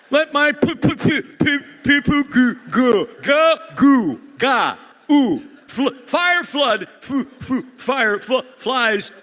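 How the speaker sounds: loud, high mock stutter through every word; hammed-up stuttering Moses pleading with Pharaoh. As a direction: hammer the stammer, getting stuck on each start. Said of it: "before Moses was able to explain".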